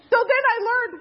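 talked through real laughter; high, laughing narration. Real, not acted. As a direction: let the laugh carry it, high. Laughing while retelling.

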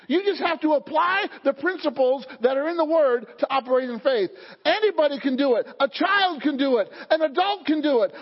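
ordinary delivery

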